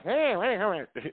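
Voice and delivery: put-on high, whiny pissed-off baby; imitating a pissed off cartoon baby, pitched high; angry baby voice. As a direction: squawk like a cranky baby. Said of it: "He's like a baby".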